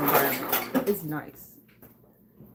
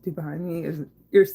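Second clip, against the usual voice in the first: usual speaking voice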